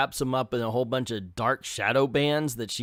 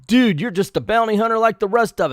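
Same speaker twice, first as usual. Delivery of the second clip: loud, higher retold taunt; mock taunt; 8 semitones higher, 9 dB louder than usual. Lupex taunting Death's Head, loud mock jeer. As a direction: jeer it loudly at him. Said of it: "Lupex is all".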